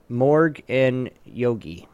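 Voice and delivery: ordinary delivery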